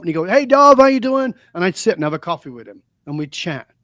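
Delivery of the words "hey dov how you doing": high, loud friendly hello; imitating a friendly street guy's greeting; cheery greeting. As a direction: call out a cheerful hey. Homeless man greeting warmly.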